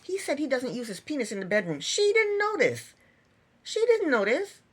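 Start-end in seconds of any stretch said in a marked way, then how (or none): 1.8-2.9 higher, slow, stressing didn't in disbelief; exasperated disbelief. Real, not acted. Reviewer marvels the couple never talked, exasperated disbelief. 3.6-4.6 high, louder, rising at the end on "didn't"; incredulous repeat. Real, not acted. Reviewer repeats the point to viewers, incredulous.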